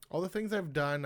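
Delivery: normal talking voice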